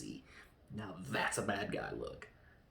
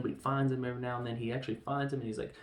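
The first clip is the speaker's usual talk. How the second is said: ordinary delivery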